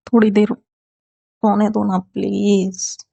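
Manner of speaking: slow, drowsy half-asleep plea; mumbled sleepy begging to stay in bed. A narrator's reading. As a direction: drag the words out as if still asleep. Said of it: "वेदा नींद में ही थी".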